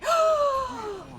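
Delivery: loud, high gasp; startled gasp. Real, not acted. Streamer caught off guard mid-stream, startled gasp. Sudden reaction in game.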